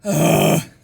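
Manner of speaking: loud, voiceless grunt; angsty teenager grunt. Shown as a demonstration. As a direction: huff like a fed-up teen. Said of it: "the angsty teenager grunt".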